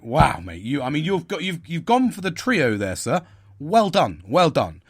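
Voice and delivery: loud, high mock congratulations; sarcastic praise. Sarcastic reply to patronising boss.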